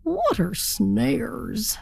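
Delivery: slow, puzzled turkey; wondering aloud. Acted. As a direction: puzzle it out to yourself. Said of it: "wondered to himself".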